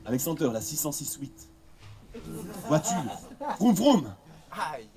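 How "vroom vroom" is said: car engine vroom. Acted.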